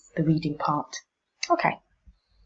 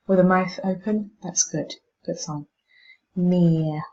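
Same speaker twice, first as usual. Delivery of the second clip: ordinary delivery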